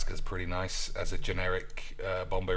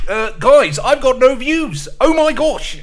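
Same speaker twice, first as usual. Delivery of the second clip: much higher, louder whiny streamer voice; Dark Side Phil impression; 13 dB louder than usual. Streamer begging chat for views, high loud whiny impression. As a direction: whine at chat like a washed-up streamer. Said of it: "I wish I could do a Dark Side Phil accent".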